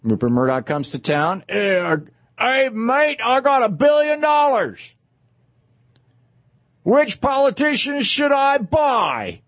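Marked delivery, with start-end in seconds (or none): 1.5-9.4 badly faked Australian, brash and drawn out; terrible Australian accent. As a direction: put on a big bad Aussie voice. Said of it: "I do a terrible Australian accent".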